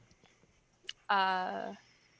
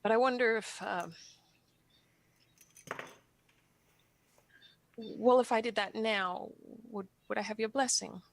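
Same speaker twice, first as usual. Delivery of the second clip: halting, on the verge of tears; hesitant confession with long, emotional pauses; tearful, holding back. Acted. Asking for a blessing to have a family, tearful. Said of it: "I was really tearing up".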